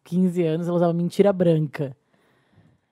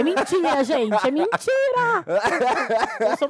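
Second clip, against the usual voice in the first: nervous laughing; 8 semitones higher, slower than usual. Real, not acted. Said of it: "eu sou uma péssima mentirosa".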